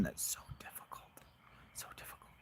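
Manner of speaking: muttered under breath, unvoiced; whispering. Real, not acted. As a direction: whisper it to yourself in frustration. Frustrated aside while solving cube.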